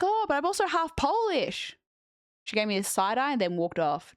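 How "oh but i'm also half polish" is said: high, eager confession; eager self-quote. Retold or imitated. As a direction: blurt it out pitched way up. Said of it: "And I'm like".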